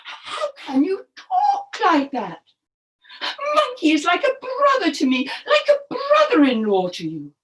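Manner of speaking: high-pitched shocked husband; scandalised storybook crocodile. Acted. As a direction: protest in dismay to a wife. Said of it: "Mister Crocodile was very shocked".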